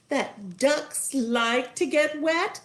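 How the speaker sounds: high, slow wife; a hint of a singsong wife; lightly voiced wife. A narrator's reading. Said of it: "said the wizard's wife".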